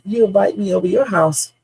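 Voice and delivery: normal talking voice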